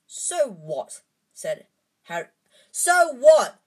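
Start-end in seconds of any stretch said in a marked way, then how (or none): 2.7-3.6 full shout, much higher and louder. A narrator's reading. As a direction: explode at friends. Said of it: "Harry shouted".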